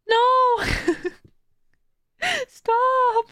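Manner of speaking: squeaky high, loud protest breaking into laughs; overwhelmed wail, imitating happy tears; mock bawling. Streamer refusing her friend's praise, mock bawling. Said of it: "like, bawling, and I was like".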